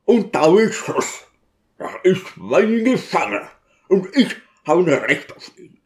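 high-pitched, strained outcry of defiance; deacon's high, indignant protest; outraged cry. A narrator's reading. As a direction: cry out in indignation, pitch far up, without full volume.

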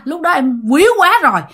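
plain reading voice

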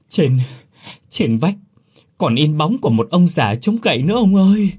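pleading to be believed, voice shaking with fear; frightened stammering. A narrator's reading. Servant boy begs the master to believe the ghost story, stammering in fear. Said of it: "vội lắp bắp kể lại đầu đuôi".

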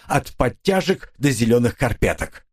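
ordinary delivery, nothing marked

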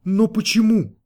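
high-pitched, bewildered question; taken aback, voice jumping up; astonished. A narrator's reading. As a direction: ask it in startled disbelief. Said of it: "изумился клиент".

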